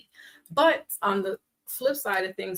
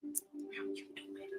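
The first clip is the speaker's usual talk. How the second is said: low breathy whisper, slow; hushed low come-on; 12 semitones lower, 18 dB quieter, slower than usual. Shown as a demonstration. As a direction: whisper it slyly.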